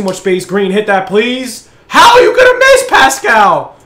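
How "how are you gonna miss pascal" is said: high, loud cry at a miss; outraged yell. Real, not acted. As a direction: yell at the player for missing. Yelling at missed shot.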